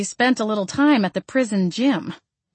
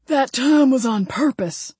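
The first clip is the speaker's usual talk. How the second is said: slow Southern man, smug; 6 semitones higher than usual. Acted. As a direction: drawl it, pleased with himself. Ray redneck voice.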